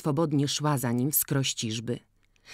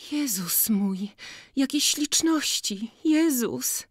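enchanted murmur; 10 semitones higher, slower than usual. Acted. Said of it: "szeptała oczarowana".

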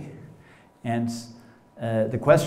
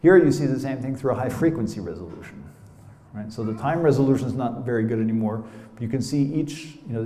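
normal talking voice

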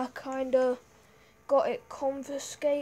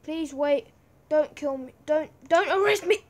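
panicked begging; 2 semitones higher, 6 dB louder than usual. Real, not acted. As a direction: beg them to stop, panicking. Begging guard as attacked.

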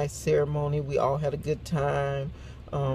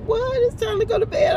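very high-pitched incredulous retelling; mock dismay at bedtime news; shocked mom; 5 dB louder than usual. Retells mom's shocked reply.

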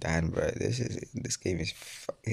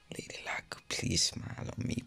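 hushed prayer to Lady Luck; whispered plea; 6 dB quieter than usual. Real, not acted. Gamer whispering to Lady Luck before the pull, hushed. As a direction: breathe the wish out under your breath.

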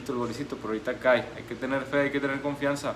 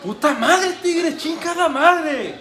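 high, loud furious cursing; angry shouting; 10 semitones higher, 6 dB louder, slower than usual. Real, not acted. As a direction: curse the rival loudly. Fan furious at rival goal.

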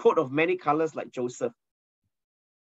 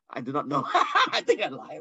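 laughing through the words; 3 semitones higher than usual. Real, not acted. Preacher laughs telling neighbour he lied, laughing. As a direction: giggle at own fib.

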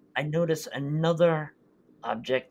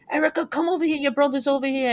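higher call across the woods; dad calling the sister over, raised; 12 semitones higher, faster than usual. Retold or imitated. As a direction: shout it out to someone far off.

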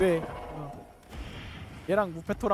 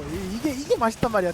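ordinary delivery

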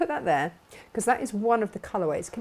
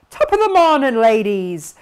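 put-on Irish accent; 12 dB louder than usual. Host reading Jacqueline's greeting, playful Irish accent. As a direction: belt out a stage-Irish hello. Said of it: "she says it in that accent".

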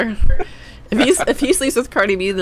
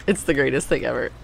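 ordinary talk

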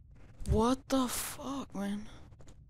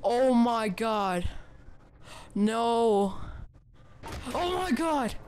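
real fright; 4 dB louder, slower than usual. Real, not acted. Player spooked in a horror game, yelling in real fright. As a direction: panic as the scare hits. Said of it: "I'm so scared right now".

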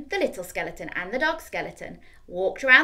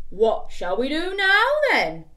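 slow, louder, higher; big skeleton; 6 semitones higher, 7 dB louder, slower than usual. Acted. Big skeleton asks, slow. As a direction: ask it slowly.

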